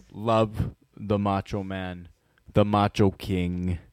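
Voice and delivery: low, slow, measured naming; solemn tribute. Acted. Declaring five friends' love for their late hero, slow solemn tribute. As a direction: pause weightily between each title.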